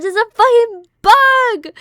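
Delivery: high, loud, slow exclamation; panicked yell. Retold or imitated. Telling mom downstairs about the bug, high slow yell. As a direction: shout the news at mom. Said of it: "I said".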